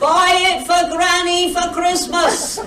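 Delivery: high, drawn-out vendor's cry; market-trader's sing-out; yelled sales pitch. Retold or imitated. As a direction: call it out slowly to passers-by. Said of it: "I yell at the passing delegates".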